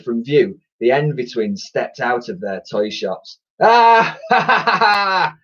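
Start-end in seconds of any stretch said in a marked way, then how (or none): 3.6-5.4 high, loud acted cackle; hammed-up evil laughter; villains laughing. A narrator's reading. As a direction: cackle gleefully as wicked twins. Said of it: "they laughed".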